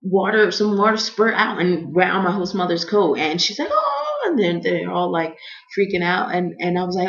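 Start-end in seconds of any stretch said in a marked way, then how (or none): 3.7-4.2 very high, held "ohhh"; high-pitched mock dismay; imitated dismayed "oh". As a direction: mimic the host mother's high cry. Host mother's cry when splashed.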